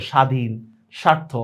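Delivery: স্বাধীন, স্বার্থ as "shadhin, shartho"; silent b-phala. Shown as a demonstration. Teacher illustrates the rule, স্বাধীন and স্বার্থ with silent b. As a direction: drop any w or b after the "sh". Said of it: "এটার কোনো উচ্চারণই হয় না".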